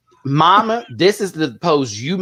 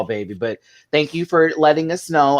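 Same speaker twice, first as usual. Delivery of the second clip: ordinary delivery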